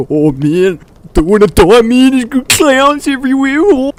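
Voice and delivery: imitating a panicking friend, climbing high and loud; hammed-up frightened wail; freaked out. Jack freaks out over the clown story, mock panicked wail. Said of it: "legitimately getting freaked out".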